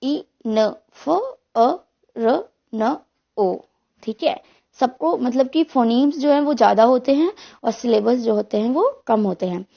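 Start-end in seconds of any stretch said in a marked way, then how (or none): 0.0-3.6 "ई न फ अ र न ओ" as seven isolated sounds; spaced-out sounds "ई, न, फ, अ, र, न, ओ"; phoneme by phoneme. Shown as a demonstration. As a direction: sound out "ई न फ अ र न ओ" slowly, pausing between sounds. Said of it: "इसमें फोनीम्स कितने".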